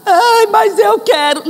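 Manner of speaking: put-on high whiny child's cry; mock tantrum, pleading and crying; a child's whining protest, half crying. Child insisting, acted dialogue.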